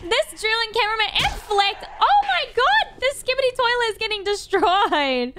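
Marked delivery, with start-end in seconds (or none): 2.0-3.0 astonished outburst. Real, not acted. Reacting to the toilet getting destroyed.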